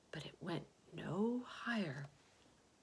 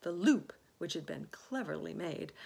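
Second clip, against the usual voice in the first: ordinary delivery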